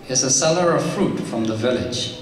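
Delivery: usual speaking voice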